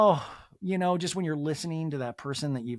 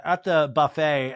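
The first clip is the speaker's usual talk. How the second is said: normal talking voice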